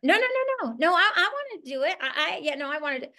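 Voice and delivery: high, pleading "no, no, no" as a keen recruit; a begging new recruit, pitched high; eager recruit begging. Retold or imitated. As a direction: play the go-getter who won't be put off. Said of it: "I want them to beg me for it".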